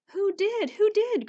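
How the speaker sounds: high, loud, eager repeated question; excited cry. Acted. Danny crying out to his father, excited and loud. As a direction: burst out with a child's eager cry. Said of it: "cried Danny".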